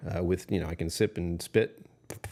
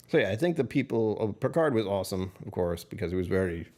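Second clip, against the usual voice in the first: normal talking voice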